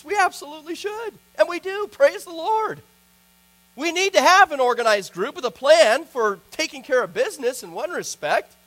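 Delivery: impassioned sermon delivery; fervent preaching. Acted. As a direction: drive it home to the congregation. Preaches on missions.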